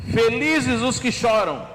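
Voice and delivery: slow, weighty reading of the verse; solemn scripture reading. Acted. Preacher opening the sermon, slow solemn reading. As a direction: read the verse slowly and solemnly. Preacher reads beatitude slowly.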